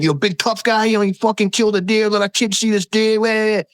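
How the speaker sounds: high, mocking New York accent; thick New York accent. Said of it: "in, like, a thick New York accent".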